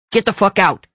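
loud furious growl; angry growl. A narrator's reading. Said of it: "he growled angrily at Riker".

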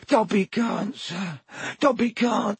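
high, cracking elf refusal; frightened elf. Acted. Elf refusing Harry's question, high and scared. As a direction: refuse in panic. Dobby voice.

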